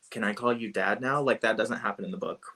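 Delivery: usual speaking voice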